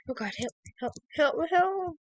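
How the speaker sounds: rising cries of help; frightened cries for help. Real, not acted. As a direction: call for help in panic. Calling for help in fear.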